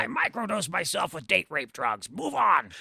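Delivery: gravelly-free deadpan mock Nolte; Nick Nolte. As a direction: Nolte brushing it off. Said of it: "I fucking love Nick Nolte".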